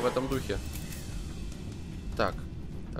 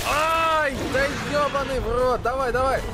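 frustrated yell; 6 semitones higher than usual. Real, not acted. Boss fight going badly.

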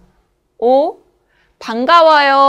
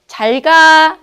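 잘 가 tossed off higher and louder; casual goodbye; 5 semitones higher, 5 dB louder, slower than usual. Shown as a demonstration. Teacher demonstrating a friend's goodbye, loud and bright. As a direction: call a cheerful bye to a friend. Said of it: "잘 가 es como 안녕히 가세요".

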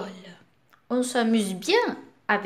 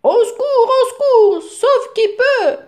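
much higher, louder playful cries for help; mock panic; 12 semitones higher, 7 dB louder than usual. Papa pretending to flee the spider, hammed-up panicked cries. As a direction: shriek for help like you're running from a spider.

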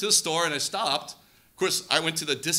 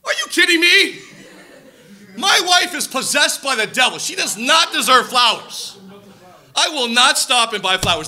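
high, loud indignant refusal; indignant outburst; 6 semitones higher, 7 dB louder than usual. Retold or imitated. Husband refusing mentor's advice to buy flowers, loud and indignant. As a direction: protest like the wronged husband. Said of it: "I go".

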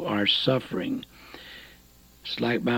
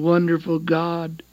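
usual speaking voice

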